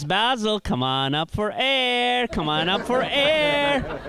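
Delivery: high, slow drawn-out call, stretching air; scuba teacher calling. Retold or imitated. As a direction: call up to him like a scuba instructor. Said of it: "the teacher says".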